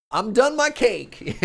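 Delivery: slow, loud, high and triumphant; mock proud declaration. Shown as a demonstration. Streamer answering the proper version with a bold slow proclamation. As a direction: proclaim it like a grand victory. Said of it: "now we're getting very proper".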